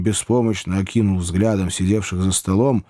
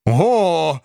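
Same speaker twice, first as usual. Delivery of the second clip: startled cry; 7 semitones higher than usual. A narrator's reading. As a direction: recoil in surprise. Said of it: "воскликнул мистер Ордль". (изумлённое «О!»)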